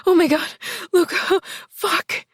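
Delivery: high loud cry of climax; ecstatic cry. Acted. Heroine's orgasm cry.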